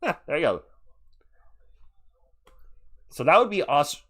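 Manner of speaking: normal talking voice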